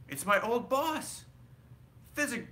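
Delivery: high, delighted recognition; light up with recognition; excited. A narrator's reading. Scrooge recognizing old boss.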